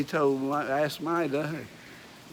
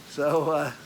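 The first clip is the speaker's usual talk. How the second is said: ordinary delivery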